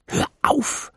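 harsh whispered order; raw, hushed "stop it"; rough whisper. A narrator's reading. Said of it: "flüsterte sie rau".

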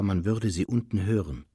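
ordinary delivery

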